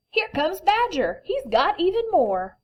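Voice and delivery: high, loud eager reply; a lively hare answering. Acted. Hare announces Badger.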